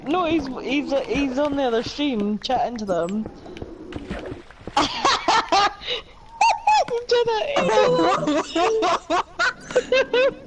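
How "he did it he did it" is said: high, laughing delight; cracking up as the joke lands. Real, not acted. Streamer laughing as teammate pulls off the prank, delighted.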